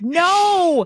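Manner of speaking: long, high swooping "no" of mock disbelief; drawn-out no. Hungover helper asked to shag balls, exaggerated high no. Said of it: "And I was like".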